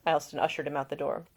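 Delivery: ordinary delivery, nothing marked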